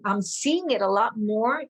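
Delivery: usual speaking voice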